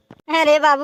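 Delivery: normal talking voice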